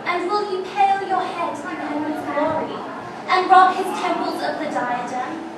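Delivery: proud, scornful queen mocking a captured enemy; Queen Margaret taunting York. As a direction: declaim it like a vengeful queen gloating over her prisoner. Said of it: "I'm actually playing the role of Queen Margaret".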